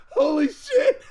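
"Holy shit!" laughed out high; laughing. Retold or imitated. Retelling his own reaction, laughing high and excited. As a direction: laugh through the exclamation. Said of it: "I was like".

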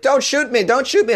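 hammed-up terrified neighbor; mock panicked plea. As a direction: beg like you're about to be shot. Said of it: "my neighbor was like".

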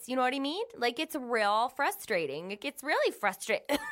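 a light Minnesota twang, grumbling; Minnesota accent. Shown as a demonstration. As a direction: keep the folksy Minnesota gripe going. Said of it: "So that would be, like, um, an example of".

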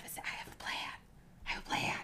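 breathy whisper, no voice. Real, not acted. Player whispering to the GM, hushed whisper. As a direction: hush it like a secret. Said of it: "Were you whispering that to me".

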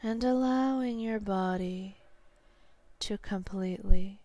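slow hypnotherapist's guiding voice, long pauses; sleep hypnosis. Real, not acted. Hypnotherapist guiding a listener to sleep, slow and soothing.

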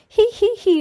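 very high, put-on tee-hee; giggling laughter. A narrator's reading. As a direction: giggle shrilly in character. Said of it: "shaking his pigtails in laughter".

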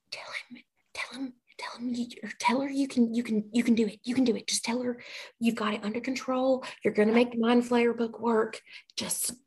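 urgent whispering, words tumbling in repeats; hushed coaching. Acted. Cerulean coaches Arnold on what to tell her, whispered urging. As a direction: prompt someone under your breath.

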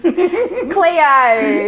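high, loud Aussie call with a laugh; Australian accent. Acted. Mock Australian catchphrases traded.

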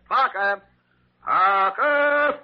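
loud, rising call for Parker. Acted. Doctor bellowing for his nurse Parker, calling out loud.

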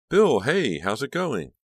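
high, lively phone hello; loud, excited opening that soars; eager greeting. A narrator's reading. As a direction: greet a boyfriend brightly, pitch way up.

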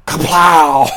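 loud gunshot sound effect; punch sound effects. Retold or imitated. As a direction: shout a sudden gunshot noise.

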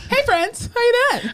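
high, chirpy fake greeting; singsong mock-friendly hello; mock cheery coworker. Mocks coworker arriving after the work.

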